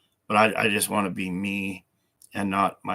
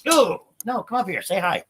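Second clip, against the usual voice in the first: pet talk to dog; 12 semitones higher than usual. Real, not acted. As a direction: coax the dog up onto the couch. Coaxing the dog up.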